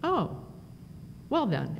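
a sudden oh as the penny drops, then a quiet well then; surprised realization. Retold or imitated. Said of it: "he looked at me and went".

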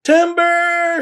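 very high, loud warning call; shouting. A narrator's reading. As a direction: shout it out as the tree topples. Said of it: "shouted Max".

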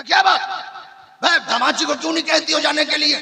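high, fast hammed-up scolding through laughs; mock scolding. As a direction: fake an angry husband. Staged quarrel, husband scolding. (नाटकीय डाँट)

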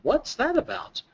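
high-pitched, questioning receptionist; lightly acted, puzzled adult talking to a child. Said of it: "the receptionist says".

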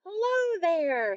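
high, loud, drawn-out call; shouted greeting. A narrator's reading. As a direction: call out to someone across a lake. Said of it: "he shouted to the duck".

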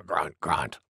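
very low, soft double grunt; grunting boar. Acted. Wild Boar after her big sneeze, low grunting. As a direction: settle into piggy grunts.

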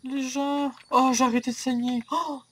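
high excited relief; relieved. Real, not acted. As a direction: burst out with relief. Bleeding stops, relief.

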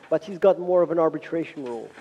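ordinary delivery

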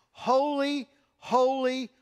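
high ringing call of holy; crying out holy. Acted. Preacher voicing seraphim crying out holy. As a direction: cry it out like the seraphim.

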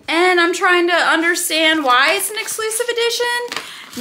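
high, exasperated whine at oneself; mock-distressed confession. Real, not acted. Confessing to viewers a forgotten preorder, high exasperated dismay. Confessing she bought the same book twice.

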